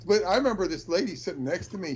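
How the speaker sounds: normal talking voice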